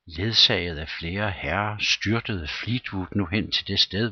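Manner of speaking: usual speaking voice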